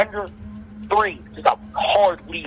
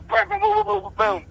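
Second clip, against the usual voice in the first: much higher, rapid booms; punch sounds. Retold or imitated. Boxer showing his record punch flurry, high excited booms.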